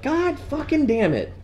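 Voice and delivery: mock exasperation; exasperated curse. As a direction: drag out the curse. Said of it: "I was like".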